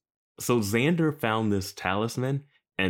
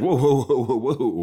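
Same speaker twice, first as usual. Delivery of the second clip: mock alarm; 5 semitones higher, 6 dB louder than usual. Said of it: "this guy's like".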